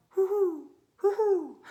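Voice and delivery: high loud hooting owl call. A narrator's reading. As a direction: hoot like an owl in the night. Owl calling in story.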